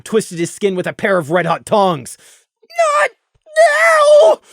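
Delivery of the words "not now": high, loud cry of pain; sudden high, loud yelp; pained shout. Acted. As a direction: yell out against the pain.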